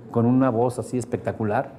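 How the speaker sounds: ordinary delivery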